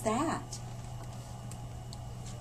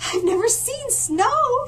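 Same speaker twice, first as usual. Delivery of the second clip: much higher and slow, marvelling; wide-eyed wonder; 12 semitones higher, 5 dB louder, slower than usual. Acted. Panda to polar bear, marvelling and much higher. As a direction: savour the wonder of snow.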